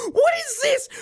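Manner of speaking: very high, laughing, disbelieving squeal; incredulous shriek. Real, not acted. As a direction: shriek it in outraged disbelief at the gadget. Mock outrage at the device.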